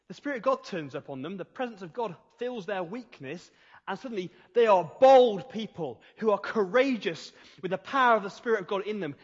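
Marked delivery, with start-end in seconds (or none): 3.9-9.1 louder, driving preacher's voice; a rousing sermon peak; bold preaching climax. Real, not acted. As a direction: preach it out with full force.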